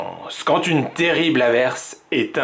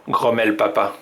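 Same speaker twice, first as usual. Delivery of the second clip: ordinary delivery, nothing marked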